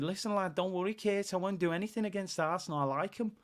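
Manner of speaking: lightly put-on Scouse, half-hearted; bad Scouse accent. Fan imitating Trent's promise in bad Scouse accent. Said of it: "I want a bad Scouse accent".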